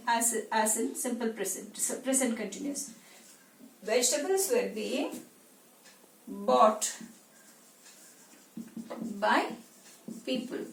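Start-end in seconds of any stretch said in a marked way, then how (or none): 3.8-10.6 long gaps between the last words; word-by-word dictation. Shown as a demonstration. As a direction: hand out the sentence piece by piece. Builds the sentence while writing it.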